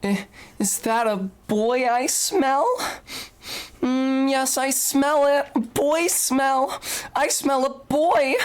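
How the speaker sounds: high breathless fangirl, savoring every word; giddy fangirl voice. Pretend. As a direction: squeal it out like a boy-crazy fan girl. Reading a paid text in voice.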